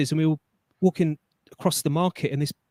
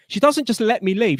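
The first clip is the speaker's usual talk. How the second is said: normal talking voice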